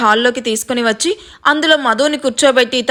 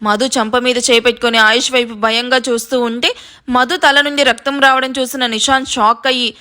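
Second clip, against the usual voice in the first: plain reading voice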